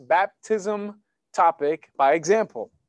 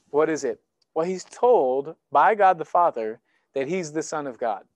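ordinary talk, nothing marked

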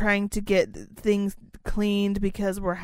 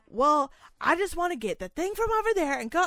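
mocking customer voice; 8 semitones higher than usual. Imitating the rude customer.

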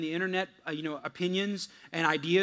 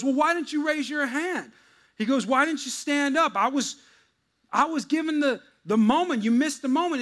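higher, louder indignant preacher; frustrated preacher; 8 semitones higher, 5 dB louder than usual. Retold or imitated. Preacher confronting the man in back, higher and indignant. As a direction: demand an answer in mock outrage.

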